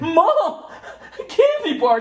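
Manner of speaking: put-on very high, loud toddler squeal with laughter in it; hammed-up child begging at full pitch; toddler tantrum. Toddler demanding candy from mom at checkout, mock tantrum. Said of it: "Uh, tantrums".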